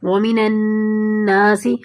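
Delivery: slow recitation, the نون of النَّاسِ held through the nose; ghunna recitation. Shown as a demonstration. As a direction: hum the doubled نون in النَّاسِ, slowly. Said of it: "نون کو نون میں ملا رہے ہیں". (النَّاسِ کے نون پر غنہ کے ساتھ آہستہ تلاوت)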